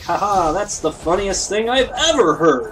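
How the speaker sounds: loud, high, fake-laughing praise; mock hilarity. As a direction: exaggerate being wildly amused. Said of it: "heard somebody do an accent and you're like".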